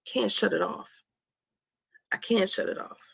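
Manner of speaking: ordinary delivery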